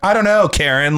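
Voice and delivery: mock irritated comeback; exasperated retort. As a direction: brush off the busybody with scorn. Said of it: "It's like".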